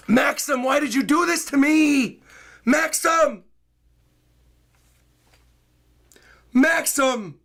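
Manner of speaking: overjoyed yelling, voice straining; excited shouting. Real, not acted. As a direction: streamer screaming a donor's name in disbelief. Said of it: "Blowing my voice out".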